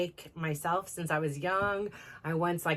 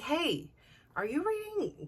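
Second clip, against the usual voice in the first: high, startled "hey, are you reading"; shocked and indignant, much higher; indignant surprise; 11 semitones higher than usual. Retold or imitated. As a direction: catch him in the act, pitched way up. Catching him reading messages.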